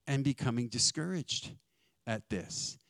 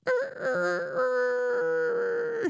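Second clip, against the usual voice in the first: long, very high cock-a-doodle-doo imitation; rooster crow; 4 dB louder than usual. Preacher marking Peter's denial, high imitated rooster crow.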